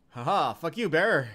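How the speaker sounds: normal talking voice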